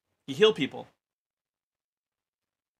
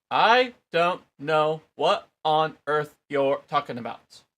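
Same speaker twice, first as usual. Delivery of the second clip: loud denial; 3 semitones higher, 9 dB louder, slower than usual. A narrator's reading. Peter denying.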